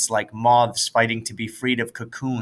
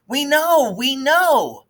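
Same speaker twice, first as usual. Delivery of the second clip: put-on high, louder, fed-up kids; impatient; 5 dB louder, slower than usual. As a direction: cut him off in exasperation. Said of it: "Everyone was impatient with Gary".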